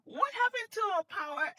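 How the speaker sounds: high, loud, baffled outcry; hammed-up bewildered dismay; acted alarm. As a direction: demand to know what just happened, comically alarmed. Reenacts alarm at the power cut.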